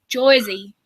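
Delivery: Jersey with an "oy" vowel in the first syllable; New Jersey pronunciation. Shown as a demonstration. Giving Nirvana a lesson in saying Jersey, Jersey as "Joisey". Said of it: "I'm gonna give you a lesson. You have to say".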